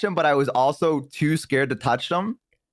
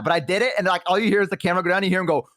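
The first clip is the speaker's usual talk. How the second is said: ordinary delivery, nothing marked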